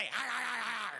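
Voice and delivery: mock snarling growls; hammed-up chomping growls; growling bite noises. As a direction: snap like a biting dog. Adult biting people at Starbucks.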